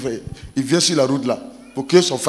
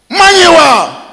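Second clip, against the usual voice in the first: high loud shout; shouted punchline; 12 semitones higher, 6 dB louder, much slower than usual. Retold or imitated. Father shouting the insult word at his brother.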